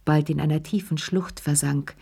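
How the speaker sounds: plain reading voice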